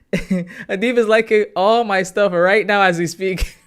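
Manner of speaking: cracking up, stretching each word out; delighted laughing disbelief. Real, not acted. Laughing as a fan likes posts.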